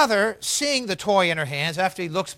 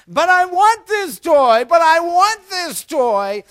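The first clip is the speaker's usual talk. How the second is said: loud, high tantrum wail; mock a little girl crying and begging; crying child; 10 semitones higher, 8 dB louder than usual. Daughter to her father after his order, mock crying. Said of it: "The daughter begins to cry".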